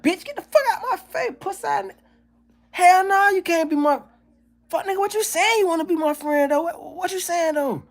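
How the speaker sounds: very high, squawking hostile voice; hammed-up shrill rejection of a would-be friend; mock outraged reply. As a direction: screech it like a street guy offended. His hostile reply to a stranger.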